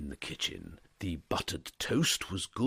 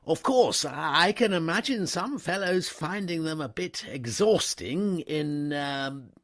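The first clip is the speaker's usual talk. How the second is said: much higher and louder, fumbling; flustered upper-class gent, pitched up; hesitant young toff; 9 semitones higher, 7 dB louder than usual. A narrator's reading. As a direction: grope for the word. Wooster fumbling for a word.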